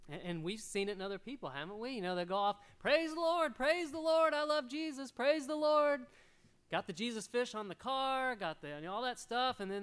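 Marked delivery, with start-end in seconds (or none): 2.8-6.1 loud, high mock "praise the Lord"; mock hypocrite praise. Pastor mocking a showy believer, loud high praise. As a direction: ham up a showy churchgoer shouting praise.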